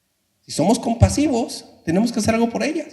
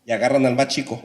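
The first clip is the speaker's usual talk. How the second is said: ordinary delivery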